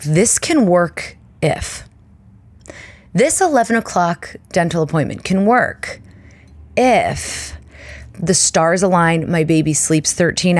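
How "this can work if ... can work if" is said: stretched-out wishful tone; slow hopeful drawl. Retold or imitated. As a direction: draw it out, hoping. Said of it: "is being like".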